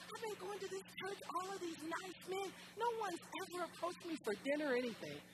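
high-pitched whiny grumble; mock complaint. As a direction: moan about being overlooked, hammed-up. Retells her old complaint at church.

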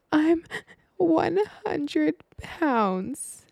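high, very slow, wailing sob; imitated hysterical crying. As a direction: wail it out like a crying schoolgirl. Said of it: "and hysterically crying".

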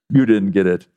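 ordinary talk, nothing marked

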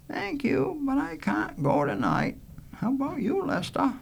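put-on high young woman's voice; high-pitched, courteous girl; polite Joy. Joy declines invitation.

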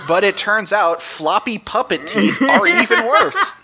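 half-laughing slow disgust; laughing through the words in disbelief. Real, not acted.

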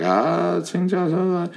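high, hesitant imitation of stammering; flustered foreign student. Startled kid forgetting his English, high flustered mock babble. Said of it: "immediately forgets English".

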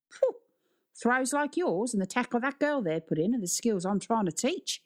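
easygoing Australian sports teacher; Australian accent. A narrator's reading. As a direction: explain approvingly in an Australian accent. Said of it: "It was Australian".

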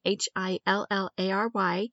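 Hillary spelt out H I L L A R Y, each letter said separately and evenly; spelling out letters. Shown as a demonstration. Podcast host spells her coupon name for listeners, letter by letter. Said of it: "So that's Hillary".

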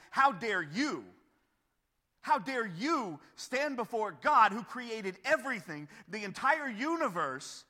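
loud, slow, emphatic scolding; stern rebuke. Acted. Paul rebuking those who challenge God, stern and forceful. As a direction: hammer each phrase as a reprimand. Paul's acted rebuke of the questioners.

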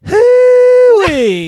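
high, shouted Southern holler; best Southern accent. Pretend. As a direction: whoop it like a cartoon Southerner. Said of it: "Give me your best Southern accent".

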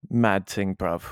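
put-on echoed London street slang accent. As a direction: join in as a London lad. Echoing the London accent impression.

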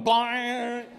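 loud, high ringing bang; imitated guitar crash. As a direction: mimic a guitar clanging over. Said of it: "a sound of a guitar going".

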